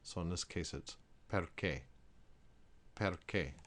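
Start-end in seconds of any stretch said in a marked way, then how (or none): 1.3-1.8 perché split per-ké with hard ch; Italian ch as k; Italian syllables. Shown as a demonstration. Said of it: "the R would go with the first syllable". 2.9-3.5 perché repeated, per-KEH; Italian ch as k; Italian syllables. Shown as a demonstration. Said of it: "the R would go with the first syllable".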